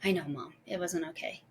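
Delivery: low, soft imitation of a contrite child; subdued admission. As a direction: admit it quietly to a parent. Calmed daughter admitting fault to mom.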